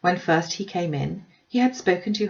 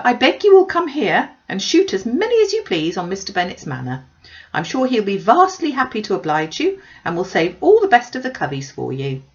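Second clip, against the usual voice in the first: high, loud, breathless gossiping mother; gushing Mrs Bennet; 5 semitones higher, 7 dB louder than usual. Acted. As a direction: pour out the news like an excited busybody. Mrs Bennet voiced by narrator.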